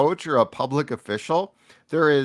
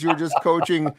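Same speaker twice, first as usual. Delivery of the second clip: ordinary talk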